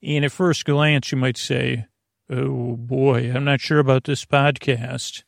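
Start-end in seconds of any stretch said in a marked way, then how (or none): none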